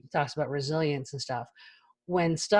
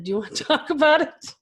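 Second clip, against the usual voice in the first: imitating her son; 10 semitones higher, 6 dB louder than usual. Mother voicing son's question.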